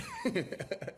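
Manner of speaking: a high burst of laughter. Real, not acted. Laugh after the punchline.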